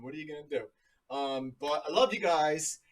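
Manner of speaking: ordinary talk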